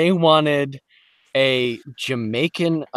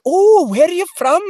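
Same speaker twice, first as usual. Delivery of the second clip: Irish or British ladies; 6 dB louder than usual. Retold or imitated. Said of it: "they were like".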